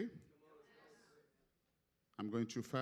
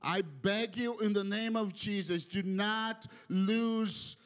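impassioned pleading; 4 semitones higher, 3 dB louder than usual. Real, not acted. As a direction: beg the congregation, building on each repeat. Preacher pleads with the congregation.

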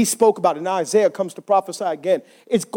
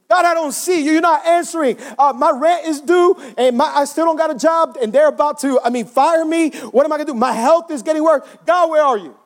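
high-pitched, exasperated complaint to God; desperate plea; 7 semitones higher than usual. Acted. Believer with rent due cries to God, desperate. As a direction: pile up grievances, pitched high.

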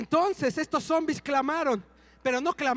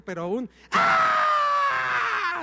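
¡ah! altísimo, fuerte y alargado; grito muy agudo y fuerte; 14 semitones higher, 6 dB louder, much slower than usual. Shown as a demonstration. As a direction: demostrar el grito de la esposa, prolongado. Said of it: "creo que esto se va a quedar cortito". (imita el grito de su esposa, muy agudo y largo)

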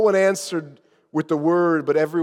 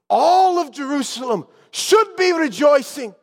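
sudden high, loud outburst; raised voice; 10 semitones higher, 5 dB louder than usual. Acted. Azariah declaring the city should rejoice, raised voice. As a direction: break out of the hush and proclaim it. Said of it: "he raised his voice at this".

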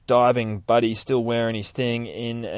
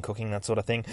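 ordinary delivery

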